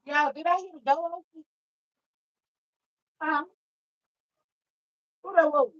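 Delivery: high, loud alarmed calling out; alarmed by the door opening, calling out high and loud; startled. Real, not acted. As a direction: call out in sudden alarm at the door.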